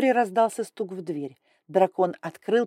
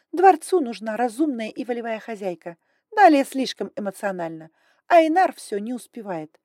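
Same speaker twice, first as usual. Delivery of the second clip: higher, louder, brisk and imperious; haughty dragon lady; 4 semitones higher, 6 dB louder than usual. Acted. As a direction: talk down like a strict aristocrat. Grai voiced high and loud.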